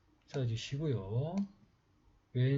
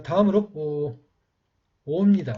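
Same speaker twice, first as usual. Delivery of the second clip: 강조해서 크게; 4 semitones higher, 10 dB louder than usual. Shown as a demonstration. Teacher announces next letter.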